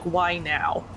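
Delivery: ordinary talk, nothing marked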